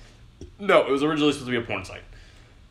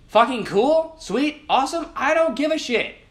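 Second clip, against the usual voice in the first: sarcastic dismissal; 11 semitones higher than usual. Real, not acted. Sarcastic dismissal of show-off posts.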